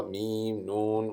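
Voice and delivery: ordinary delivery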